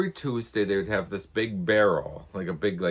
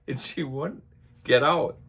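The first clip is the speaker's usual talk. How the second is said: ordinary talk, nothing marked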